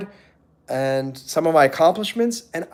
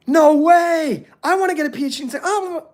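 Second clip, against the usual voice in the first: amazed squeal; 10 semitones higher than usual. Retold or imitated. Crowd gushing at the PhD, mock amazed squeal. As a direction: gush like a starstruck fan.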